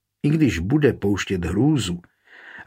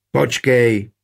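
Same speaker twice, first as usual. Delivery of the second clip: restrained shout to halt someone; called out; 3 dB louder than usual. A narrator's reading. Knight to guest heading for the door, contained shout. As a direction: call out to stop them leaving. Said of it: "křikl Burjan z Gutštejna".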